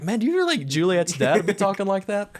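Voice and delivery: high, disbelieving question; mock the line with disbelief; incredulous quote. Friend mocking how Juliet's dad talks, high disbelief. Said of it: "mock incredulous".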